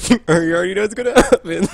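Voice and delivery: normal talking voice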